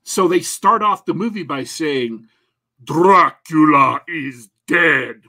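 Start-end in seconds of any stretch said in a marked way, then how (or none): 2.8-5.2 put-on slow, heavy vampire accent on Dracula is dead; spooky Dracula accent. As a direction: draw it out like Count Dracula in a thick accent. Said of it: "They don't say it in that accent".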